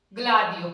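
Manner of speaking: ग्लादियो said loud, glaa-dee-yo with a hard ग; loud pronunciation demo. Shown as a demonstration. Teacher demonstrating ग्लादियो to students. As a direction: punch out ग्लादियो clearly. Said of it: "ग और ले बन गया ना".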